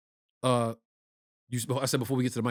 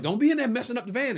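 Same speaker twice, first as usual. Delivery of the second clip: mock mother scolding, much higher; a mom's high-pitched warning; a mother warning the kids off the van, mock high voice; 10 semitones higher than usual. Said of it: "my mother be like".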